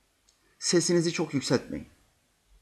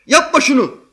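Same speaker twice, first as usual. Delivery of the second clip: loud, high protesting yell; irritated shout; 9 semitones higher, 8 dB louder than usual. Retold or imitated. Child yelling at friend scraping bottle cap, loud high protest. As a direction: shout at him to stop it. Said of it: "Ve şöyle der".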